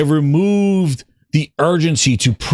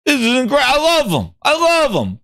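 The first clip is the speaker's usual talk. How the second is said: excited Satya impression; 10 semitones higher than usual. Mimicking the CEO raving about PlayStation, excited and high. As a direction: gush in a much higher voice. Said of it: "He's like".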